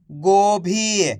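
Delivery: गोभी very slowly, both vowels held long; read with its मात्रा. Shown as a demonstration. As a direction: stretch गोभी syllable by syllable. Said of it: "मात्रा लग जाएगी तो मात्रा के साथ पढ़ देना है". (गोभी को धीरे, मात्रा के साथ खींचकर)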